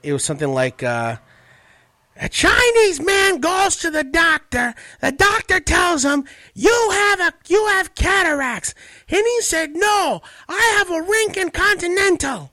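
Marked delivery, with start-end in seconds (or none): 2.2-12.5 loud, very high, slow comic voice; Gilbert Gottfried. Retold or imitated. Retelling a posted joke to the co-host, loud high-pitched impression. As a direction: belt the joke out high and drawn-out. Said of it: "I remember a joke".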